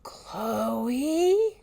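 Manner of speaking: a drawn-out, rising call from below; eerie distant call. Acted. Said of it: "Emily's muffled voice drifted up".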